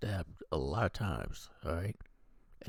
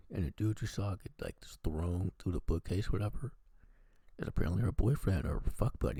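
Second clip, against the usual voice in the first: ordinary delivery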